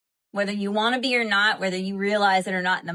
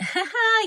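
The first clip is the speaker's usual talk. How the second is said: high burst of laughter; 5 dB louder than usual. Real, not acted. Laughs at own accent slip.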